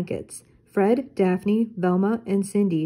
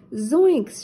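mild shout; 5 semitones higher than usual. Acted. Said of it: "Shaggy shouted".

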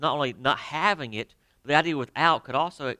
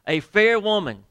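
high, loud, ringing proclamation of A fair woman; preacher's booming emphasis; loud emphatic call; 7 semitones higher, 8 dB louder than usual. Real, not acted. Addressing teenage boys, punching phrase.

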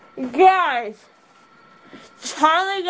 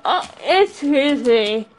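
normal talking voice

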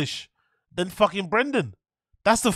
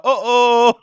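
louder, high meme uh-oh; 4 semitones higher, 7 dB louder than usual. Acted. Doing a meme catchphrase for chat, loud uh-oh. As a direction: belt the uh-oh like a sound drop.

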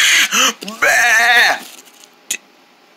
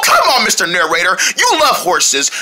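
ordinary talk

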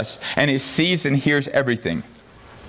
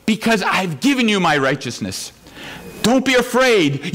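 raised, higher, urgent assurance; fervent preaching; 7 semitones higher than usual. Acted. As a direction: proclaim it with rising passion. Preacher voicing Jesus, fervent.

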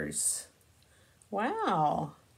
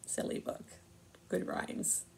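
whisper; 11 dB quieter than usual. Real, not acted. Closing aside.

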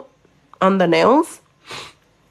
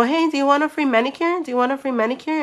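high, quick salesgirl offer; eager mock sales call, raised pitch; street pitch; 7 semitones higher, much faster than usual. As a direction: imitate pitching a freebie to passers-by. Acting out pitching to passers-by.

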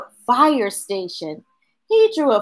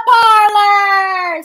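put-on excited child; 10 semitones higher, 6 dB louder than usual. Charlie calls out pizza parlor.

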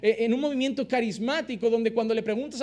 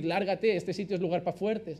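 much lower, softer cold brush-off; mock dismissive church; 9 semitones lower, 6 dB quieter than usual. Church turning away a struggling believer, low cold dismissal. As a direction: push the weak believer away with a low sneer. Imagined church rejecting weak member.

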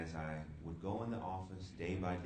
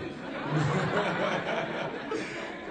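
loud laugh; 11 semitones higher, 10 dB louder than usual. Real, not acted. Laughing at own joke.

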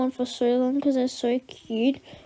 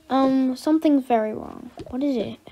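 ordinary talk, nothing marked

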